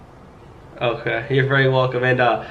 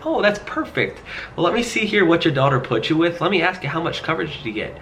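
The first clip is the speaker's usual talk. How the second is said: bright, higher, eager-to-help tone; upbeat sales voice; 6 semitones higher than usual. Retold or imitated. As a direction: sound cheerful and helpful. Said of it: "And I said".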